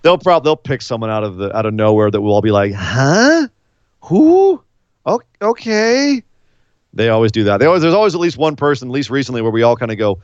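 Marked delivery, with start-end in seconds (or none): 2.7-6.2 high, confused double take, slowing to a drawn-out okay; mock confusion, high and puzzled; baffled huh who. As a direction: act totally lost at a surprise name. Confused reaction voiced.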